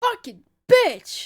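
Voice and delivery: loud, high, drawn-out curse; pained angry cursing. A narrator's reading. Soldier just stabbed in the leg, pained cursing. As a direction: curse out slowly through the pain. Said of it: "as he groans in pain".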